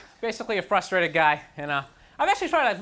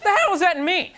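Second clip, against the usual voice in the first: loud disbelieving question; 9 semitones higher, 7 dB louder than usual. Retold or imitated. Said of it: "I was like".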